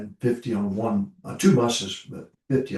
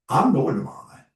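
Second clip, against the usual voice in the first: announcing to church; 7 semitones higher, 5 dB louder than usual. Retold or imitated. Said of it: "I would say".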